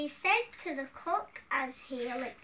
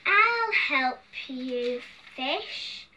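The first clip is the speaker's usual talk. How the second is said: loud, very drawn-out words; hearty king's offer; 8 dB louder, much slower than usual. Acted. Hungry king offers the scared cook help, loud and emphatic. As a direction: boom it out slowly, rising at the end.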